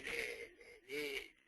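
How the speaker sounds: ordinary talk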